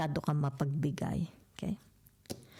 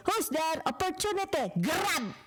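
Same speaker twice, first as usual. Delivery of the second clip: much higher, eager voice; playful high joke; 12 semitones higher than usual. Pretend. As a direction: act out the punchline, urging to grab. Acted knock-knock exchange, grabbing. (masigla at mataas na boses sa biro)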